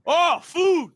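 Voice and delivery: high, loud burst of joy; mock delight. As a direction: yelp it like a starving man spotting dinner. Reginald's mock glee at the bird.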